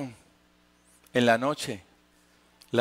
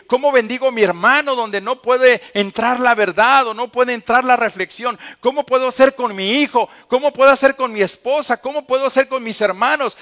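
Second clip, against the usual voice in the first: much higher, louder pleading questions; impassioned preached prayer; 8 semitones higher, 9 dB louder than usual. Acted. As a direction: pour out the questions in a rising cry. Preaching plea at full force. (súplica predicada con fuerza)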